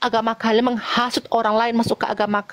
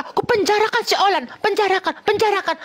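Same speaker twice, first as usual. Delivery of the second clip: quoted taunt; 9 semitones higher than usual. Retold or imitated. Quoting opponents' threat in argument.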